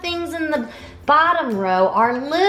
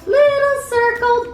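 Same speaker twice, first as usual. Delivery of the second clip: little voice; 12 semitones higher, 3 dB louder than usual. Shown as a demonstration. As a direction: squeak it small and high. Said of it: "say hi with a little voice".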